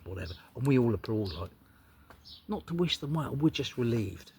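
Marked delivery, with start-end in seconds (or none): none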